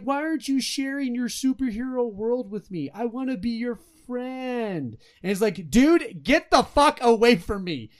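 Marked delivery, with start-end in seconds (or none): 0.0-5.0 high, needy mock pleading; Zeke. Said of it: "That's what Zeke's doing". 5.7-7.9 loud, high mock-fed-up outburst; acted exasperation. Acted angry brush-off of Zeke.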